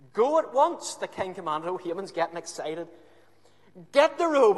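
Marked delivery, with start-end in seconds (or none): 0.1-1.0 loud, high royal command; a king barking an order. Acted. 3.9-4.6 high, loud eager order; a king ordering the robe; excited command. Acted.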